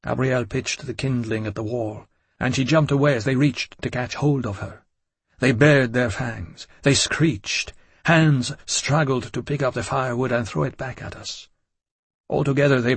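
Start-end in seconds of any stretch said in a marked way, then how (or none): none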